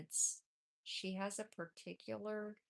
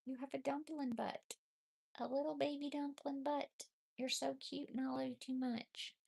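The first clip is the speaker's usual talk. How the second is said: high, tender coo at the kitten; cooing pet talk; 8 semitones higher than usual. Real, not acted. Owner cooing over the kitten's cute looks.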